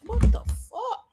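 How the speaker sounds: high, drawn-out stunned curse; shocked outburst. Real, not acted. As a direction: blurt it out in real disbelief at your eye. Shocked at own shut eye.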